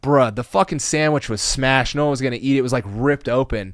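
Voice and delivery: retold heated self-defence; indignant protest. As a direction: argue back in disbelief. Retold indignant defence over sandwich.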